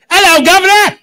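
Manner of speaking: loud, high-pitched Cockney cheer; cute little Cockney accent. Acted. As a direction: holler it like a chirpy Cockney. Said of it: "little Cockney accent".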